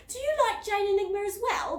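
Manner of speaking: high, loud delighted surprise; gasping. A narrator's reading. Fan girl gasps at the mouse's books in surprise. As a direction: gasp at the discovery. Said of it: "gasped Susie".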